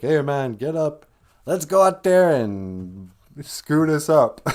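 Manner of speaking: rally your friend, half-joking; mock locker-room cheer; pep talk.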